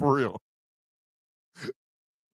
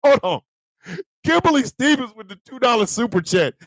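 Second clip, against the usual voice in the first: ordinary talk, nothing marked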